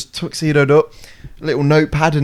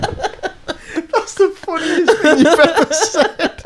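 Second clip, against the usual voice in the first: high, slow words squeezed out through laughter; barely getting it out while cracking up; real helpless laughter; laughing hard; much slower than usual. Real, not acted. Helpless laughter after joke.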